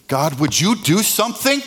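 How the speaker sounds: high, loud demanding plea; demanding prayer. Retold or imitated. Grieving believer pressing God, loud demand. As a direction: push it up and loud, desperate. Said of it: "you can get very demanding".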